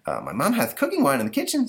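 put-on high, eager kid voice; young boy Stanley. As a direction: answer like a little boy. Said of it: "says Stanley".

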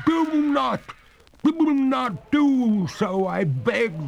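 very high, loud, slow pleading cry; ghost crying out. A narrator's reading. Ghost crying out to the master not to sit. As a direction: beg him desperately not to. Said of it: "cried the ghost".

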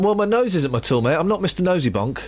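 ordinary talk, nothing marked

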